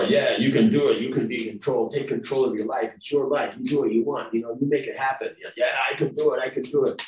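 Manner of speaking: lightly mocking can-do cheer; mock pep talk. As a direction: pump yourself up like a motivational poster. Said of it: "You know, or".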